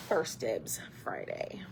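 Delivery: breathy secret whisper, slowed down. Real, not acted. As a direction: lean in and whisper the name like a secret. Playful aside to viewers.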